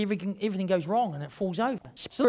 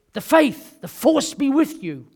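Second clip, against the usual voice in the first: Star Wars line; 6 semitones higher, 10 dB louder, slower than usual. Retold or imitated. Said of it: "Jedi, as in Star Wars".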